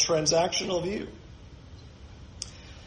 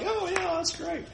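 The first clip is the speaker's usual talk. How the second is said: mock approval; 11 semitones higher, 6 dB quieter than usual. Mock of the reply Jesus never gave.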